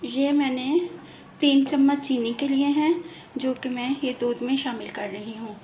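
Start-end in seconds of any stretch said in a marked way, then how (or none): none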